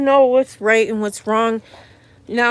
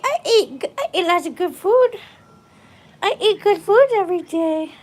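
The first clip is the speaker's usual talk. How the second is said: voicing the baby; 8 semitones higher, 4 dB louder, faster than usual. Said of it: "she say".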